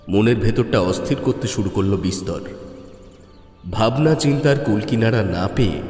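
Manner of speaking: usual speaking voice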